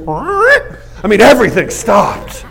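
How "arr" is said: record scratch noise. Retold or imitated. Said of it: "takes a record and goes".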